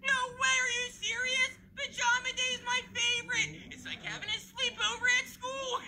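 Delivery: put-on high-pitched, excited little-boy voice; thrilled kid brother. Billy hears it's Pajama Day at school, thrilled. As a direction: squeal with excitement like a gullible kid.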